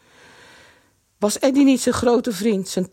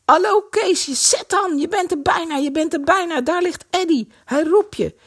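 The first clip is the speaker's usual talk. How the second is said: calling out; 8 semitones higher than usual. A narrator's reading. Someone urges Kees on from shore.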